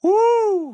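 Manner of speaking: loud, high-pitched "woo"; long, high, real cheer; celebratory whoop. Real, not acted. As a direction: let out a big whoop of relief at the finish. Celebrates reaching end of show.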